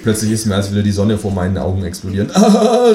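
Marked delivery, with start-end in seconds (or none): 2.3-2.9 sudden high yelp of pain; mock scream. Narrator blinded by the flashlight, high yelp. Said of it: "schrei ich".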